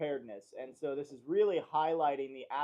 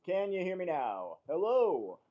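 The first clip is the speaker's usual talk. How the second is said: loud mic check; 4 semitones higher, 7 dB louder than usual. Real, not acted. Loud mic check then intro.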